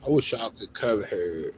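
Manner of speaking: ordinary talk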